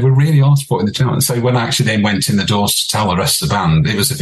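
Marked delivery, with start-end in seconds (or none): 0.0-1.1 louder, higher, fast call across the room; calling after him. Retold or imitated. Singer calls after the drummer walking out, fast and loud. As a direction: shout it after him as he leaves.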